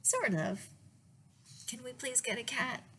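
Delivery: ordinary delivery, nothing marked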